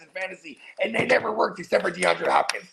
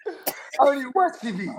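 mock crying; 10 semitones higher than usual. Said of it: "mock crying imitation".

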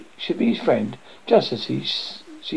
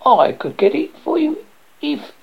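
high-pitched little frog voice; bargaining frog; 13 semitones higher than usual. A narrator's reading. Frog offers to fetch the ball, high creature voice. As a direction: pipe it up as a storybook frog.

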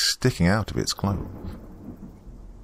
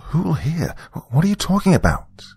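much higher, exasperated acted questions; harsh questioning; 11 semitones higher than usual. A narrator's reading. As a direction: demand it with an irritated edge. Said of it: "I asked harshly".

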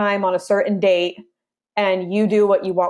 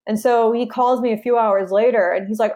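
ordinary delivery

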